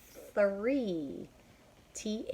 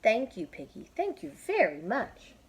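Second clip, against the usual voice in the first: grateful Elephant; 5 semitones higher, 6 dB louder than usual. Acted. Elephant thanks Piggy.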